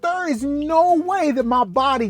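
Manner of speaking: shocked disbelief. Retold or imitated. A man hears he may have a blood clot, squealing in high disbelief. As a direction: act out refusing to believe the news.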